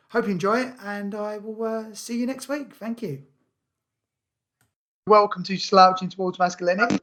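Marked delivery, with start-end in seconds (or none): 5.1-7.0 radio DJ voice. Real, not acted. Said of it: "stop sounding like a radio D J".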